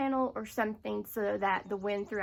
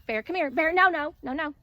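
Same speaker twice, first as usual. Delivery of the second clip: loud, sharp "no, no" at the dog; scolding the dog; 5 semitones higher, 10 dB louder than usual. Real, not acted. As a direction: shout the dog off, firm and high.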